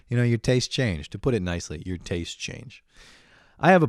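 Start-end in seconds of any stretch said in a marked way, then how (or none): none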